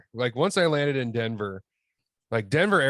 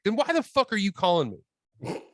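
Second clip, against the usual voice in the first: higher-pitched, exasperated comeback; fed-up, incredulous pushback; exasperated retort; 8 semitones higher than usual. Retold or imitated. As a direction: retell it as an irritated challenge. Retells exasperated reply to coworker.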